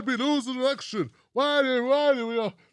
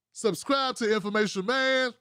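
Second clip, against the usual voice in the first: usual speaking voice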